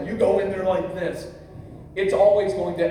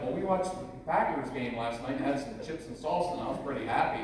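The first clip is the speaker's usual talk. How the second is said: mild low mumble; 5 semitones lower, 7 dB quieter than usual. Retold or imitated. Husband's own retold reply.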